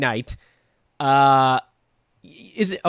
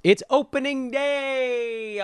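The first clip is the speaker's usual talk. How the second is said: high excited call; 10 semitones higher, much slower than usual. Acted. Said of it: "I'll lean back from the microphone".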